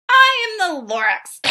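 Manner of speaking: high, loud creature announcing itself; Lorax voice. Acted. The Lorax confronts the Onceler, a high loud voice.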